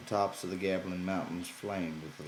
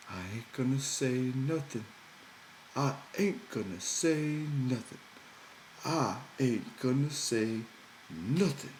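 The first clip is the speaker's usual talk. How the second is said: put-on higher, childlike simple man; Lenny's voice; 6 semitones higher than usual. A narrator's reading. Lenny promising George he won't talk, higher childlike voice.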